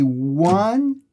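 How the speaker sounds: slow, steady counting of the beat; slow counted rhythm. Shown as a demonstration. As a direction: count each beat slowly along with the strum. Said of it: "just so you hear the rhythm".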